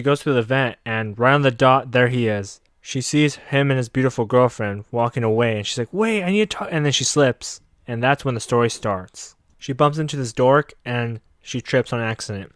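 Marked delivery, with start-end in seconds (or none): 5.9-6.7 high-pitched imitation of calling after someone; mock urgent call. Acting her calling after him.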